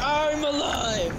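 slow drawn-out victory yell; triumphant shout. Real, not acted.

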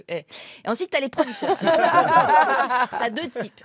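ordinary delivery

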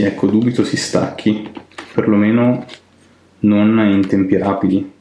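ordinary talk

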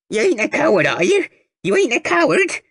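put-on high, rising needling old woman's voice; taunting old lady. A narrator's reading. Toothless old lady pushing the boy forward, taunting. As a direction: jab at him with every word.

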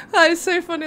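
high, breaking up with real laughter; laughing. Real, not acted. Streamer amused by a viewer's name joke, real laughing. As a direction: talk through a fit of giggles.

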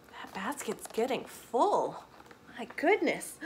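ordinary delivery

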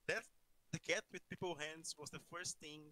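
ordinary talk

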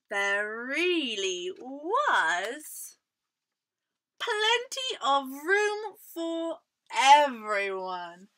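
slow, stretched storybook wording; drawn-out happy ending. A narrator's reading. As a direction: savour the ending for young listeners. Storyteller slowly delivers happy ending.

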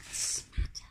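soft aside to herself; hushed self-correction; whispered correction. Real, not acted. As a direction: whisper the fix quietly. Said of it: "narrator corrects herself aside".

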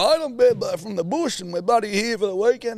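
high, put-on ocker bloke voice; bush bloke dad. Dad to taxi driver, in a high ocker bloke voice.

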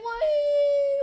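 high, loud drawn-out whee; excited whee. Real, not acted. Player flying toward the finish, loud high whee. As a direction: squeal a long whee.

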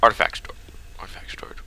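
unvoiced whisper. Real, not acted. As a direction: whisper the sign softly. Reading sign in whisper.

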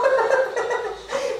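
burst of laughter. Real, not acted.

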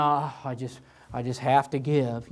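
low, resigned mock grumble; reluctant. Grudging churchgoer facing the offering, reluctant. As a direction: imitate a grudging giver. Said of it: "don't view an offering as something as".

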